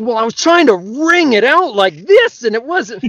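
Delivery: high, odd patient voice breaking into a laugh; high silly patient voice into laughter. Acted. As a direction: deliver the punchline high, cracking up. Punchline in patient voice.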